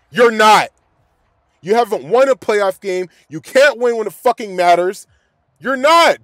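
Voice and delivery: loud furious yelling at the player; angry shout. Real, not acted. Fan yelling at his team's quarterback, loud and furious. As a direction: shout it out in frustration.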